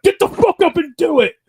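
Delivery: put-on high, loud barked order at a drunk partner; retold yell. Husband yelling at his hammered wife to get up, loud shout. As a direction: yank her off the couch with a big shout. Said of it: "I had to yell at you".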